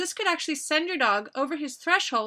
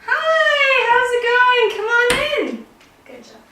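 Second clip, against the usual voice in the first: high, loud, bubbly welcome; excited greeting; 13 semitones higher, 10 dB louder than usual. Acted. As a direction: cheer the guests in. Greeting visitors, urging the dogs.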